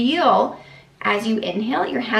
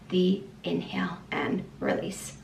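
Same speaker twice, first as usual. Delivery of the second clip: soft whisper, leaning in; whispered reminder; 4 semitones lower, 10 dB quieter than usual. Real, not acted. Coach to new moms, whispering the key reminder. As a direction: breathe it like a secret tip.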